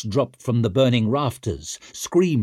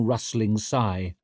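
plain reading voice

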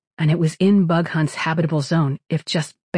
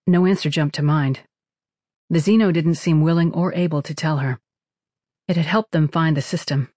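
plain reading voice

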